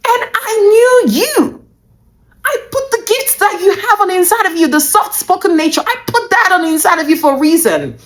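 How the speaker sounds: high-pitched, fervent declaration; impassioned voice of God. Acted. Preacher speaking as God about gifts placed inside, impassioned. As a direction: proclaim it ardently, well above normal pitch.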